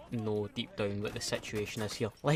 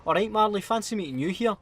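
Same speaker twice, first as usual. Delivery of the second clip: cheery greeting; 7 semitones higher, 5 dB louder than usual. Retold or imitated. Fan greeting in pub toilet.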